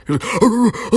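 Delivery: high, rolling mock barks; imitated dog bark. Mocking a man who barked at her on the stairs, imitated barking. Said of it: "barking like a fucking dog".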